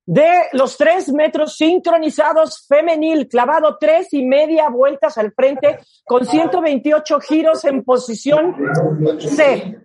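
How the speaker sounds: excited sports commentator; live play-by-play. Pretend. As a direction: call the dive live, rising to shouts. Mock live dive commentary.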